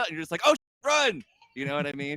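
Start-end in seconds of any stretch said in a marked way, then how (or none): 0.5-1.2 high, loud startled cry; mock panic dash to the TV; excited panic. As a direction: blurt it out, rushing off. Rushing to the TV.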